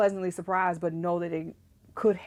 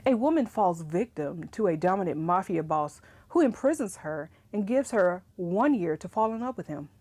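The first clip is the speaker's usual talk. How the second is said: usual speaking voice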